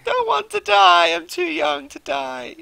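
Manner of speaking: loud, high, drawn-out wail; hammed-up despair. Streamer as his dying game character, wailing in mock despair. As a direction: wail it slowly like a melodramatic death scene. Acted pleading as character nears death.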